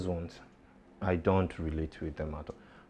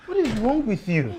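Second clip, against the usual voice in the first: shocked; 8 dB louder than usual. Acted. Shocked at drunk wife.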